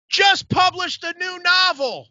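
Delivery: imitated high, slow yell; John. Friend volunteering him to the comedian, mock yell. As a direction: call it out high across the room, mocking. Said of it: "John yells out".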